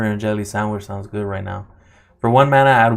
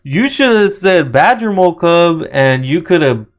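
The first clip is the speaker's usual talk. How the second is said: higher and louder, fired up; excited; 5 semitones higher, 6 dB louder than usual. Real, not acted. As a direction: tease with excited energy. Excited teasing after the pull.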